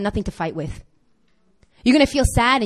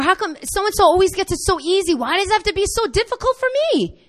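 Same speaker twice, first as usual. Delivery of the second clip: whiny complaint; 8 semitones higher than usual. Retold or imitated. Voicing complaints to God.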